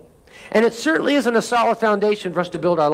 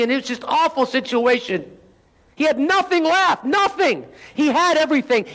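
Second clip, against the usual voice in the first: preaching build; 4 semitones higher than usual. Acted. Preacher to congregation voicing Job's suffering, rising preaching. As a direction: voice climbs higher through Job's list of losses.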